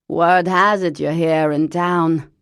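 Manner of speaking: loud, put-on voice of an old chain smoker; Lavinia's horrible voice. Cousin Lavinia phoning Parker, loud put-on smoker's voice. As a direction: bark it out like a lifelong smoker. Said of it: "Lavinia said".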